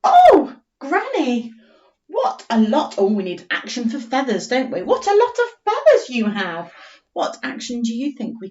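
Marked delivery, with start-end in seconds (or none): 0.0-2.9 high excited squeal then slow; squirrel voice. Acted. Teacher voicing the squirrel to Granny, squealing. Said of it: "The squirrel said". 4.8-6.7 lifted, wondering squirrel line; high squirrel voice. Acted. Teacher as squirrel marvelling at Granny's feathers. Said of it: "The squirrel said".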